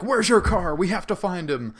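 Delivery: retold panic, pitched high; mock freak-out. Seminar leader to the guy with him, mock freaking out. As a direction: imitate someone frantic about a missing car. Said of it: "he just starts freaking out".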